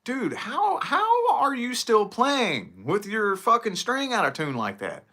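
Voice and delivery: high, incredulous rant at the band; exasperated disbelief. Retold or imitated. Retelling a bar band out of tune, a high incredulous rant. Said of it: "I'm like".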